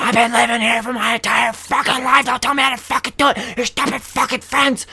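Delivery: high, strained angry yelling; furious interrupting scream. Retold or imitated. Driver cuts the old man off, screaming furiously. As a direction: cut in screaming, outraged. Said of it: "I cut him off".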